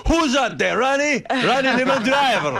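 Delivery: put-on high, loud, delighted Italian accent; hammed-up Italian salesman shouting a name he knows. Salesman recognizing the stylist's name, loud Italian accent. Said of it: "he had some foreign accent".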